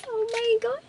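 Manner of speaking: delighted squeal. Real, not acted. Tasting candy, delighted.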